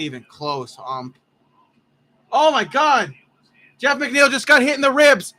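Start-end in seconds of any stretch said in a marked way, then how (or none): 2.3-5.3 loud, high-pitched alarm; shocked outcry. Real, not acted. Host seeing McNeil get hit in the ribs, shocked outcry. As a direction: exclaim in real shock, raised voice.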